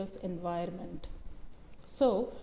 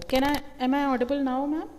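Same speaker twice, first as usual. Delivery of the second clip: raised voice to be heard; louder; 4 semitones higher, 7 dB louder than usual. Real, not acted. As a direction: speak up for the mic. Checking mic after complaint.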